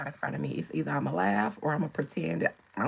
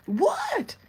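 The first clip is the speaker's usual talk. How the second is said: put-on much higher, louder innocent old-lady squawk; shady old lady; 12 semitones higher, 5 dB louder than usual. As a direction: play dumb, loud and shrill-pitched.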